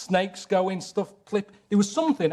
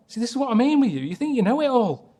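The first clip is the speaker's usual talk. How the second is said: exasperated retort; 7 semitones higher, 4 dB louder than usual. Real, not acted. Exasperated argument.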